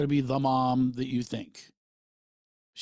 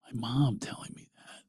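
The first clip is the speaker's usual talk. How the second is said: whispered, overcome; moved whisper. Real, not acted. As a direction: hush it, touched to the core. Moved, recalling mom's words.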